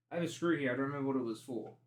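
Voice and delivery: ordinary talk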